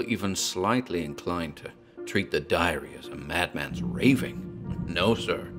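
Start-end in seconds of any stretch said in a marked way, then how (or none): none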